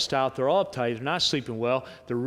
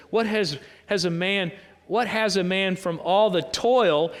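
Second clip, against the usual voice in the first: scripture reading; 4 semitones higher, 3 dB louder than usual. Acted. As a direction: read the passage out with weight on key words. Reads scripture aloud.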